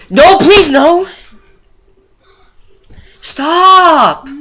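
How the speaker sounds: high, loud hammed-up pleading; playful mock scream of no and stop; mock pleading shout. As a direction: yelp it out like a mock victim.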